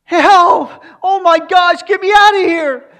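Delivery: screaming high and loud for help; panicked yell. Retold or imitated. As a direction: shriek like the lion is upon you. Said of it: "he starts yelling".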